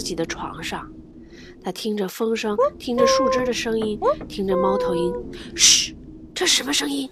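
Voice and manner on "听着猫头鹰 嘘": ordinary delivery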